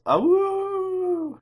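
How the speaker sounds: long high wolf howl. Pretend. Wolf howl after wolves.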